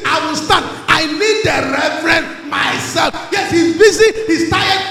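loud, emphatic refusal to be put off; insistent woman's demand. Acted. Shunammite woman demanding the reverend himself, loud insistence. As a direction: shout it, driving each phrase home. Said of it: "learn the Shunammite woman. The Bible said his child was dead. And say".

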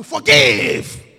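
loud shouted forgive, dropping low; a father's loud shouted command; imitated shout. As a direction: bark forgive like Father Abraham. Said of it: "Father always shout at you".

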